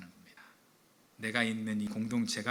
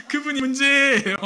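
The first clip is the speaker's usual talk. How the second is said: high, loud gleeful jab; much higher, louder joking jab; gleeful punchline; 13 semitones higher, 12 dB louder than usual. Real, not acted. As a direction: land the joke with delight. Punchline after laughter.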